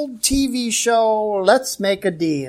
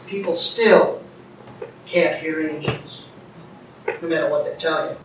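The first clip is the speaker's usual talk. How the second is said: lower, softer, measured statement; quiet aside; 6 semitones lower, 5 dB quieter than usual. Real, not acted. Preacher telling folks about angels, quiet understated delivery. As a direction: say it low and restrained. Quiet take before emphatic repeat.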